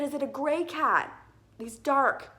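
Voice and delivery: loud, high urgent question; retold shout. Owner asking a neighbor if it's a gray cat, retold shout. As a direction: re-enact calling across to another balcony. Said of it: "so I was shouting at him".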